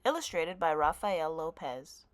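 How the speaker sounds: ordinary delivery, nothing marked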